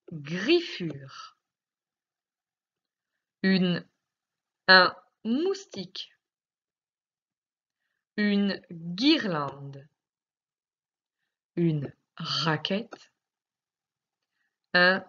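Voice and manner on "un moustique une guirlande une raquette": each word drawn out slowly so the k sound stands out; slow dictation. Shown as a demonstration. Teacher dictates words to a pupil, slow and drawn out. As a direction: stretch the words so a child hears the k. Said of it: "quand tu entendras le son k".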